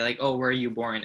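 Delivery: ordinary delivery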